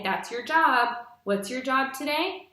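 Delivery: ordinary delivery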